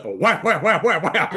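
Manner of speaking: rapid high whap-whap noises; imitated fan slaps. Fan blade hitting a bird, high mimicked whapping.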